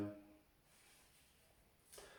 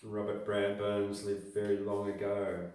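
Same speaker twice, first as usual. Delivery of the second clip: plain reading voice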